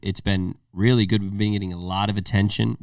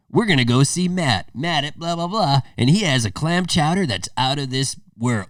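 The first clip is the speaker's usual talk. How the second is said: pitched-up TV host hype, lightly voiced; Guy Fieri impression; 6 semitones higher than usual. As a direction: mimic a pumped food-show host. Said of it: "Guy Fieri's like".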